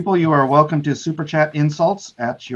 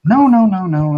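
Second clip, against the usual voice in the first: emphatic; 6 semitones higher, 6 dB louder than usual. Real, not acted. Firm denial of the horse head.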